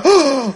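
sharp surprised gasp; demo gasp. Shown as a demonstration. Coach shows how to react to a stranger with a gasp. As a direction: gasp as if amazed at the answer. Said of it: "You have to do the".

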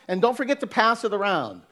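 ordinary delivery

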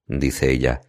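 ordinary delivery

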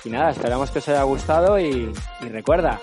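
upbeat sign-off announcer; podcast outro. Acted. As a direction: wrap up the show brightly. Outro sign-off over music.

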